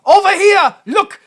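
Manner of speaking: high, loud frantic pointing and insisting; John Boyd insisting to everyone he's right here. Retold or imitated. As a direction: babble it like a desperate know-it-all. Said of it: "the part I like about John Boyd when he's like".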